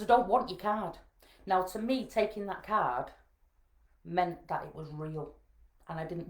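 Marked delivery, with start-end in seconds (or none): 0.0-1.0 louder, higher acted refusal; retold firm refusal. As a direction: dig your heels in with the nurse. Second, firmer refusal of card.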